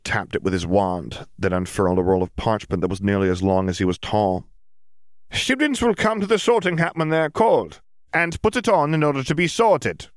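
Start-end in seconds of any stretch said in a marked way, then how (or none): 5.3-10.1 high-pitched official announcement; squeaky-high little man addressing a hall; little professor announcing. A narrator's reading. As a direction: proclaim the rules in a very high voice. Small professor addresses the assembled students.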